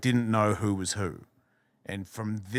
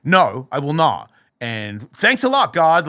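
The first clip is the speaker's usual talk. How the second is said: defiant; 3 semitones higher, 8 dB louder, slower than usual. Retold or imitated. Retells defiant answer to voice.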